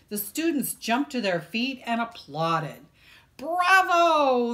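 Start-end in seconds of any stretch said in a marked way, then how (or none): 3.4-4.5 loud, high cheer of a crowd. A narrator's reading. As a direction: shout it like a thrilled kid. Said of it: "they cheered".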